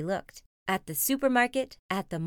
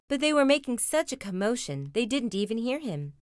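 ordinary delivery

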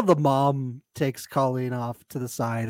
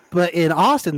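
ordinary talk

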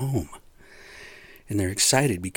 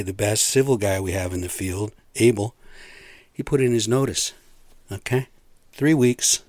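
ordinary talk

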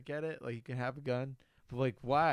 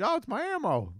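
high, chirpy mock coworker voice; a cheery gun guy's pitch; mock proud ammo buyer; 11 semitones higher than usual. Coworker cheerfully claims his ammo delivery, high mock voice. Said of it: "It gets delivered".

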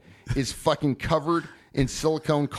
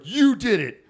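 higher, louder, retold accusation; mock angry accusation; 8 semitones higher, 5 dB louder than usual. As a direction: act out an outraged friend pointing blame. Retold accusation, man with knife.

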